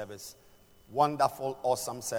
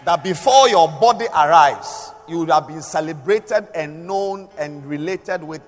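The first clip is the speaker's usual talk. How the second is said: full-force preaching; 4 semitones higher, 12 dB louder than usual. Acted.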